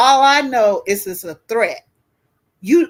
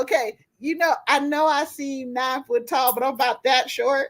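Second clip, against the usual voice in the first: usual speaking voice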